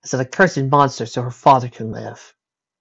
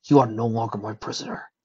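tender dragon voice. Acted. Spike releasing Rarity.

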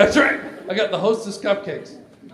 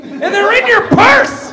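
slow, loud, high yell; hammed-up furious punchline; shouted jab; 11 dB louder, slower than usual. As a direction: hurl the insult at full volume. Climax of acted quarrel.

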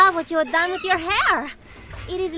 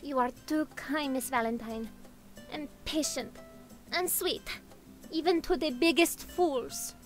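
much softer, sugary apology to the customer; quiet fawning; 4 semitones lower, 11 dB quieter than usual. Acted. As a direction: lower it to a hushed, sweet murmur. Lotus fawns quietly at customer.

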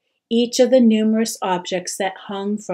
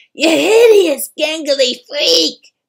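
loud, slow, higher taunting yell; jeering cry; 8 semitones higher, 9 dB louder than usual. A narrator's reading. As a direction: spit the insult slowly. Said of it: "they cried".

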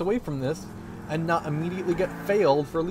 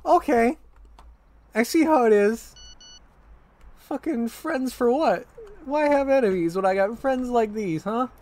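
mock indignation; 7 semitones higher, 3 dB louder than usual. Abandoned by friends in game.

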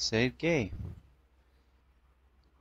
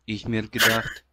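ordinary talk, nothing marked

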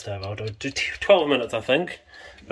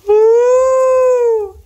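high, slow delighted squeal; excited squeal; 8 dB louder, much slower than usual. Real, not acted. Excited at pizza in oven.

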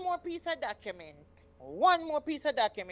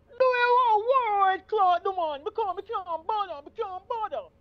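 a lightly voiced husband, higher and louder at the start; imitating her husband; 8 semitones higher, 6 dB louder than usual. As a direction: play the man pleading, then brushing it off fast. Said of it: "every time you do an impersonation of him".

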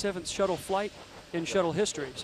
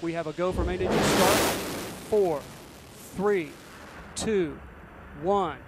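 counting down to go; slower than usual. Real, not acted. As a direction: count down building to liftoff. Launch countdown to liftoff.

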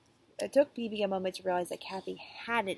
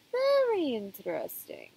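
slow, thoughtful doctor voice; a lightly voiced pondering doctor; musing psychiatrist; 4 semitones higher, 4 dB louder, slower than usual. Doctor Pickle musing to rude Kathy, slow and thoughtful. Psychiatrist strokes beard, muses.

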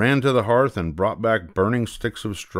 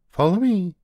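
very high, louder bird voice; bird chirp; 11 semitones higher, 4 dB louder than usual. Acted. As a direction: chirp it like a blackbird. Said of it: "the bird chirped".

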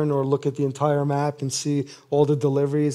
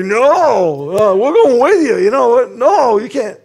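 loud, higher insistent objection; loud stubborn protest; Peter protesting; 5 semitones higher, 8 dB louder, slower than usual. Retold or imitated. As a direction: push back hard. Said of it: "He's like".

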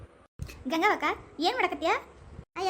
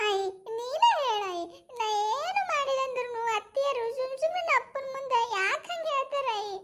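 put-on high complaining child's voice; a small girl asking why she's blamed; little girl; 9 semitones higher than usual. (ಎತ್ತರದ ಧ್ವನಿಯ ಪುಟ್ಟ ಹುಡುಗಿ)

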